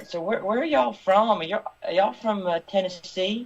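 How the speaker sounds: high, slow Southern drawl asking where they're from; Southern tour guide. Retold or imitated. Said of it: "and she said".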